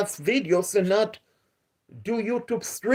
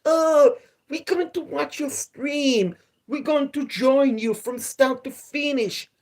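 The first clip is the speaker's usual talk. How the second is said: mocking quote; 6 semitones higher than usual. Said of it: "people who stated".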